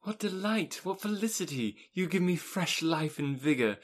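rapturous. Acted. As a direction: let the joy spill over about the trip. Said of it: "she rapturously cried".

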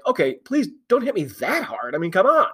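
mock pleading, pitch swinging up; Damian McKenzie. Voicing a player begging not to be tackled, mock pleading. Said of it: "Damian McKenzie looked like".

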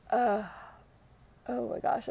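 talking through a big yawn; words stretched by a yawn. Real, not acted. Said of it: "I'm yawning now".

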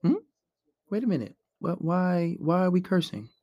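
soft, puzzled questioning; hushed, genuinely confused objection; calmly baffled. Shown as a demonstration. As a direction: act almost lost, quietly asking why. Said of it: "almost lost as to why".

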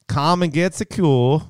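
slow, laughing attempt at an American accent; a shaky first go at general American. Shown as a demonstration. Reading a drill line to his co-host in an American accent. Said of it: "I gotta do an American accent".

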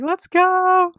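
high, drawn-out mock cheer; playful, high-pitched hype call; imitated rallying cry. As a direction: imitate a high whoop to get moving. Voicing ladies honking, cheering.

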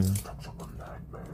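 whispered metal band name; hushed echo. Pretend. Host trying out a metal band name, whispered.

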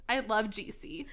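ordinary talk, nothing marked

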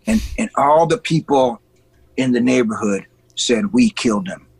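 ordinary talk

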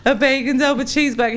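high, whiny-sweet begging; pleading pregnant wife. Retold or imitated. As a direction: play up the pregnant wife's begging. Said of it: "that stereotypical pregnant wife".

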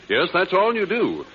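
ordinary talk

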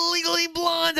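high-pitched mock nasal rant voice; imitating nasal rant. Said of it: "nasal voice".

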